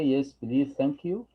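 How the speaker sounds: ordinary talk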